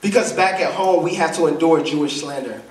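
very low, subdued voice of John; understated grief, sunk deep in pitch; weeping John's answer. Acted. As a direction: answer low and heavy, without sobbing. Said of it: "he's sobbing uncontrollably".

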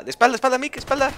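high, loud warning call; urgent shout. Real, not acted. Warning teammate behind him.

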